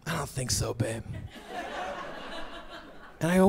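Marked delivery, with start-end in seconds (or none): 0.1-1.0 low, soft wife voice; wife's voice. Retold or imitated. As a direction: let him down gently. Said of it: "she goes".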